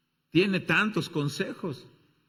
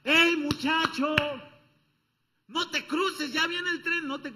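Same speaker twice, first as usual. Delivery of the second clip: shouted warning; 12 semitones higher than usual. Acted. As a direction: scream at the boy to stop. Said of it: "la gente le grita".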